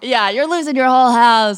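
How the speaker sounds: loud, high mocking taunt. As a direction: gloat over her loudly. Joking aggressive bit.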